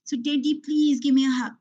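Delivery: put-on high, childlike begging for a hug; a small daughter pleading with daddy not to leave; pleading little girl. Little daughter begs her father for a hug, high and pleading. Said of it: "imagine, little girl pleading".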